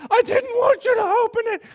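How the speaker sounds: put-on high, loud bratty child protest; furious child yell. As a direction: shriek it like an angry nine-year-old. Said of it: "she switched on me and went".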